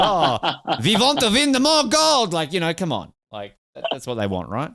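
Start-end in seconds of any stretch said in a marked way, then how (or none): 0.8-2.3 high, loud hammed-up German accent; cartoonish German accent, pitched up; bad German accent. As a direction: put on a terrible mock German accent. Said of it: "That was a bad German accent".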